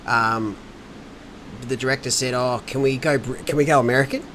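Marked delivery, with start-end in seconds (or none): none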